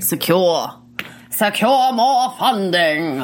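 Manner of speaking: lightly imitating a weird stagey southern accent, slow; mocking Augustus's accent. Echoing the speaker from the clip in a mock southern stage accent. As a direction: parrot his odd southern stage accent, drawn out. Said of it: "It's like a weird southern accent".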